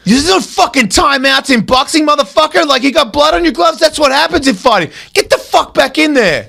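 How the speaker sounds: high, scolding trainer yell; trainer chewing him out. Retold or imitated. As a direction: tear into the fighter for quitting. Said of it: "the trainer goes".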